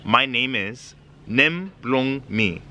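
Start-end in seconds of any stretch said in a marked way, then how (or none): 1.3-2.6 stretched out word by word; slow, separated language lesson phrase; slow teaching demonstration. Shown as a demonstration. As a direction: say each word slowly for learners. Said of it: "teaching you some basics".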